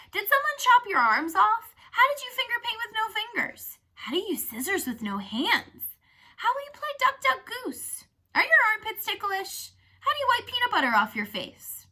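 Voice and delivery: high, loud mimicked little kids; little kid voices. Girl mimicking the kids' questions at lunch, high kid voice. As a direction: pipe up like curious small children. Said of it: "I mimicked little kid voices".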